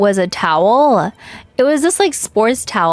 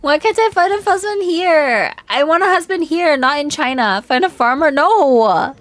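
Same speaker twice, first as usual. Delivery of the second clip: exasperated whine; 7 semitones higher than usual. Acted. Mock whiny reply to chat.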